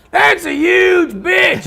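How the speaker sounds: huge high, slow shouted line with a laugh in it; gravelly yell. Retold or imitated. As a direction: belt the insult out to the room. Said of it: "had a nice little gravel".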